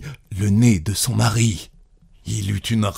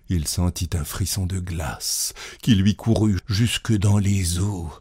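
ordinary delivery, nothing marked

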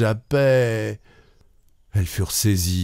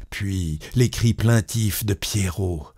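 usual speaking voice

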